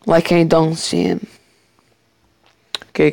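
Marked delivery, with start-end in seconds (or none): none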